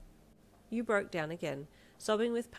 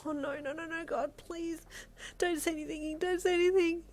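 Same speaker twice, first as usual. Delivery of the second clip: high, rising frantic plea; panicked inner scream; 11 semitones higher, 4 dB louder than usual. A narrator's reading. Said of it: "you screamed internally".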